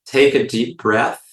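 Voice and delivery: unhurried phrases with long silences between; guided meditation. Real, not acted. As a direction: lead listeners gently through each breath, in and out.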